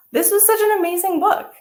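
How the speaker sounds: high, loud delighted praise; excited. Real, not acted. Reader closing the story, excited. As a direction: gush about the book.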